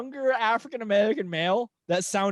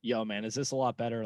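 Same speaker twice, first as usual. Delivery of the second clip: put-on low, softer voice; deeper voice; 8 semitones lower, 6 dB quieter than usual. Asking a doubting player if this is better, deeper voice. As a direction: drop your voice well down, as if disguising it. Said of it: "talked in a deeper voice".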